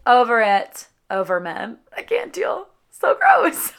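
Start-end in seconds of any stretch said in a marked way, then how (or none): 2.0-3.7 disgusted. Real, not acted. Disgusted about men.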